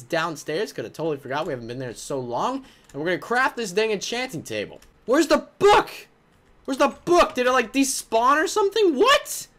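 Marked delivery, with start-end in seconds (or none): 5.1-9.5 high, loud rising alarm; alarmed disbelief. Real, not acted. Player finding his book missing, high alarmed disbelief. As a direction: sound shocked the book is gone.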